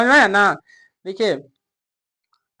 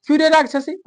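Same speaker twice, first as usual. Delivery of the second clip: higher, sharp mock rebuke; scolding insult; 6 semitones higher, faster than usual. Teacher repeats the mother-in-law's insult for the class, in a higher mock scold. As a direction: fling the insult at her in a raised voice. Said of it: "हम गाली दिया ना".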